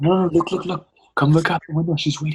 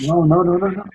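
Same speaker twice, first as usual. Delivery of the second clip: frantic denial; 6 semitones higher, 4 dB louder, faster than usual. Acted. Luis trying to calm her.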